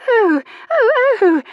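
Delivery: very high, loud flustered squeaks; squeaking in alarm. A narrator's reading. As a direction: squeak in a panic. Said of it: "Charlotte squeaked".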